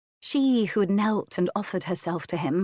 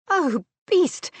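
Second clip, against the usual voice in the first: high, loud mock outrage; playful cry; 11 semitones higher, 6 dB louder than usual. A narrator's reading. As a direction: squeal it in pretend anger. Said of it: "she cried".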